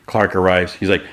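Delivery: usual speaking voice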